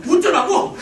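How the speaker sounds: high, explosive mock yell; loud acted outburst; exasperated retort. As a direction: throw it back at full volume.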